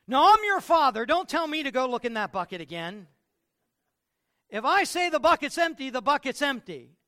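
high, loud outraged dad; father shouting down his son; indignant father. Acted. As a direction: put the boy in his place. Father angrily refuses.

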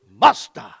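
low, loud "Basta"; forceful demo. Shown as a demonstration. Preacher driving the word home to the congregation, low forceful shout. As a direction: drop low and boom out "Basta". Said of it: "you gotta do it like this".

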